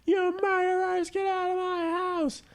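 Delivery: very high, slow screeching; mock shriek. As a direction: screech it like a hysterical host. Jennifer Lawrence screaming at cult.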